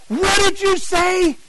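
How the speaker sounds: high-pitched, drawn-out incredulous demand; startled outburst. Retold or imitated. Startled listener questions the evangelist, high incredulous outburst. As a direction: stretch it out high, stunned by the words. Reacting startled, retold exchange.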